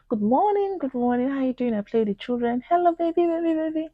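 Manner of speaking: high sing-song friendly greeting; overly sweet neighborly hello; cheery greeting. Retold or imitated.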